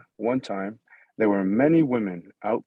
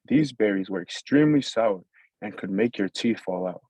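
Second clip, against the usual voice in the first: plain reading voice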